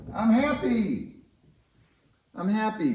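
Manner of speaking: a contented cartoon atom, drawn out; blissfully slow, high "I'm happy"; happy magnesium. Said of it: "this magnesium says".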